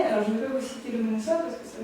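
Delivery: ordinary talk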